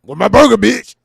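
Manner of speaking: [name] impression. Imagining [name] eating a cheeseburger, loud bark. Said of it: "Can you imagine [name]".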